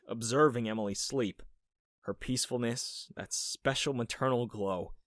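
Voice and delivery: plain reading voice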